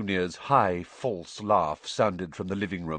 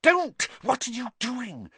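alarmed, high urgent hiss; sharp first word, then urgent and held in. A narrator's reading. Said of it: "Harry hissed".

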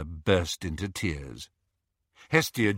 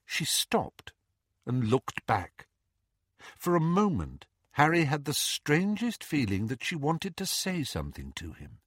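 ordinary delivery

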